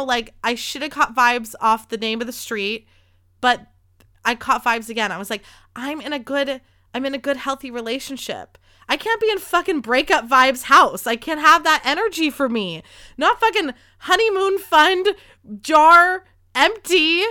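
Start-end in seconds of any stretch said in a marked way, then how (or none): 5.8-17.2 building rant. Retold or imitated. Ranting to herself about bad vibes.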